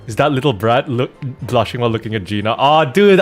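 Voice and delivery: ordinary talk, nothing marked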